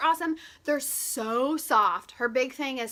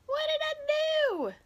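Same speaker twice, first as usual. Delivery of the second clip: high, slow, drawn-out what did I do; hammed-up bewildered whine, higher and slower; mock dismay; 13 semitones higher, slower than usual. After the camera slips, she asks viewers in high, slow mock dismay.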